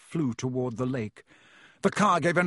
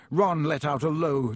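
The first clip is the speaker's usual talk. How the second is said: plain reading voice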